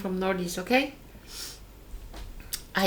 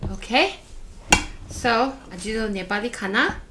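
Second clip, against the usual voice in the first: normal talking voice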